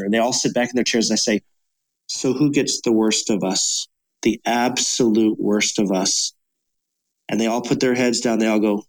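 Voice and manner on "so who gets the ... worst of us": slow, grave question. Acted. Pointed question to the audience.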